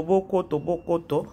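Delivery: usual speaking voice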